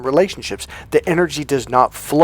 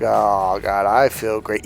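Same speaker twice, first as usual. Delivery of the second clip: lower, slow, a touch of dreamy relief; relaxed bliss; 4 semitones lower, slower than usual. Retold or imitated. Man as oxytocin hits, mildly blissed-out and slow. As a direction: sink into the good feeling. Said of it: "you're like".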